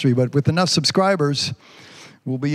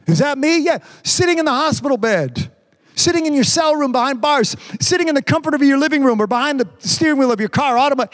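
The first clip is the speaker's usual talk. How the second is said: raised, sermon cadence; preaching; 6 semitones higher than usual. Acted. Pastor preaching to his church, full sermon voice. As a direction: preach it to the congregation.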